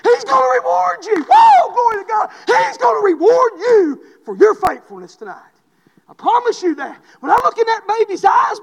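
rising to a loud, high revival shout; preaching at full force. Acted. Preacher urging believers to keep standing for God, shouting. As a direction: build into a fervent preacher's cry with whoops.

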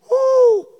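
high loud preacher's woo; excited holler. Real, not acted. Preacher hollers over opening the Bible, excited whoop. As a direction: whoop it out in joy.

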